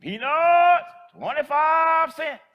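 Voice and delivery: high, loud, drawn-out peddler's cry; loud, slow hawker's call down an empty sidewalk; street vendor's call. Retold or imitated. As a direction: call it out long and high like an old street seller. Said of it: "She stops".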